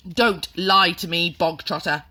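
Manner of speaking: loud, stern bark; barked warning. A narrator's reading. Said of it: "barked the Trunchbull".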